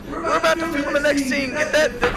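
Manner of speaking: high-pitched distant director's call. Acted. Director calls crew to film next scene, high holler.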